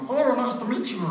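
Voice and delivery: high silly creature greeting; chicken monster voice. Retold or imitated. Actor greeting a little person in monster voice. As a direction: keep the monster voice without thinking. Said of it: "I'm just still doing the voice".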